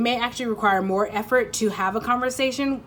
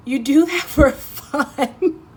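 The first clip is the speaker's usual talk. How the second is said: higher, slow, laughing tease; 6 semitones higher, 7 dB louder, much slower than usual. Acted. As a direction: draw it out through a laugh. Teasing the listener.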